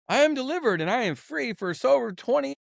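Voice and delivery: mocking quote. Mocking others' claims of deliverance.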